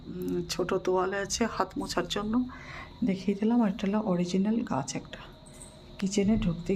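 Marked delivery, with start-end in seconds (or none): none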